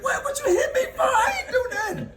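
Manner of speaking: very high mock-crying whine; squealed, laughing complaint; whining protest. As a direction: imitate a kid wailing innocence through laughter. Retold kid protesting being hit.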